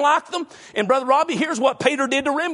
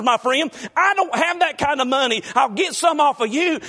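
raised, driving preacher voice; preaching; 5 semitones higher than usual. Acted. Preacher urging the church to fill pews first. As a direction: push it out like a revival preacher.

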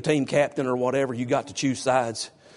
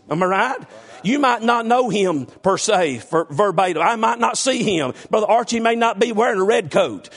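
raised, higher-pitched pulpit delivery; fervent preaching; 7 semitones higher, 4 dB louder than usual. Acted. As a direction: drive it home to the congregation with fervor. Preaching at full force.

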